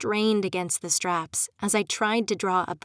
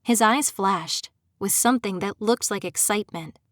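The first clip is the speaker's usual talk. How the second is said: plain reading voice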